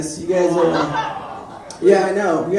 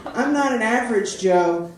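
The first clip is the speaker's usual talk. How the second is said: normal talking voice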